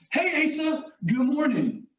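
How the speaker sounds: imitating a high, upbeat hello; mock cheery morning greeting, head held high; proud, cheerful greeting. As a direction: greet the boss brightly, pitched well up. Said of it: "They were holding their heads high".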